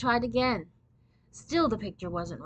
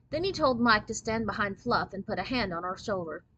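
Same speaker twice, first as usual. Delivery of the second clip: ordinary delivery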